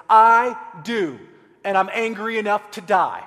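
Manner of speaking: put-on loud, sulky child's retort; defiant outburst. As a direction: snap it back like a stroppy kid. Jonah as sulking kid.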